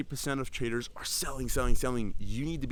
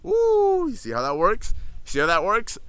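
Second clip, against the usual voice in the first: excited; 10 semitones higher, 9 dB louder than usual. Real, not acted. Excited reveal to viewers.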